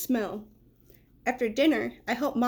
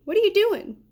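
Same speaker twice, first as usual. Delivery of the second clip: puzzled dog; 9 semitones higher, 5 dB louder than usual. Acted. Dog voiced asking.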